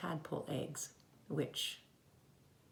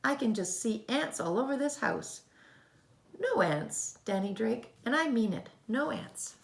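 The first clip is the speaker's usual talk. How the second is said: firm scolding; 7 semitones higher, 5 dB louder than usual. Acted. Mother forbids ants.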